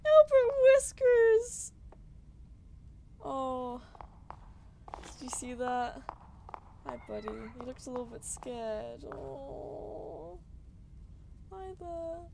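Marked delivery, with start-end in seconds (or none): 0.1-1.7 higher and louder, stretched out name; excited call. Real, not acted. Streamer spots the cat, excited call. 6.9-12.2 much softer, gentle voice for a pet. Real, not acted. Streamer greets a scared cat, soft baby talk.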